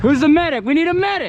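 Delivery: high, loud call for a medic; man down shout. Real, not acted. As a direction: yell for help across the field. Calling for medic after hit.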